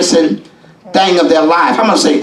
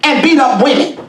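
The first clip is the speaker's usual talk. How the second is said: preaching shout; 6 semitones higher than usual. Real, not acted. Preacher to the congregation, condemning abusive fathers, in a shout. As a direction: let the anger rise into a preacher's shout.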